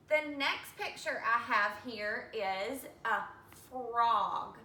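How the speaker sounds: ordinary talk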